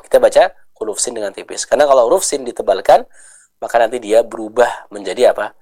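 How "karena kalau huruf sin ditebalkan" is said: normal talking voice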